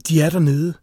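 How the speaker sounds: frightened woman calling out. A narrator's reading. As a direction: cry out the alarm, short of a scream. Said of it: "Hun skreg".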